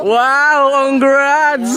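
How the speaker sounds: loud, high teasing cheer; mock congratulations. Vlogger teasing a friend about last night, loud mock cheer. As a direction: cheer it out big, half joking.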